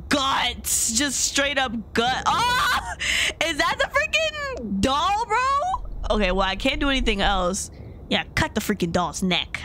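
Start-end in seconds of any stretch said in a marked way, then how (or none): 2.3-6.1 high, alarmed exclamation; jumpy shock at the creepy doll; startled. Real, not acted.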